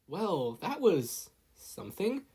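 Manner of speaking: slow, dazed remark; stunned. A narrator's reading. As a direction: still reeling from the blast. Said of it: "still in shock".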